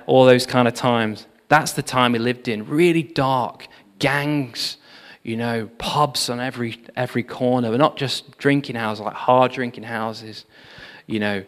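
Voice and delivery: ordinary talk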